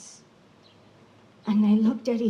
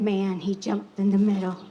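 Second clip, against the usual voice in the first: ordinary delivery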